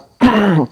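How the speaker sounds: harsh throat clear. Real, not acted. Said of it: "Now I'm losing my voice".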